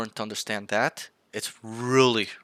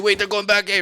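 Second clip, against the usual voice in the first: high, louder acted alarm; startled callout; 10 semitones higher, 5 dB louder than usual. Player warning teammates of a rotation, mock alarmed. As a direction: call it out as a sudden realization.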